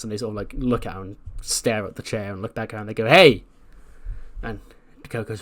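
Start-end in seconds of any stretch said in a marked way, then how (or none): 3.1-3.4 sharp, loud attention-getting hey; calling out. Retold or imitated. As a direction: call out to stop someone passing. Calling out to Dakota in retelling.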